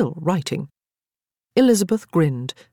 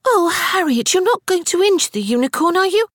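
put-on much higher, pleading child; horrified little girl; 3 dB louder than usual. A narrator's reading. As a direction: beg in childish dismay. Frances horrified for unicorn.

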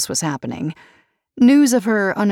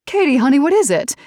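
tight, worried; 9 semitones higher, 6 dB louder, faster than usual. A narrator's reading. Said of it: "voice drew up tight".

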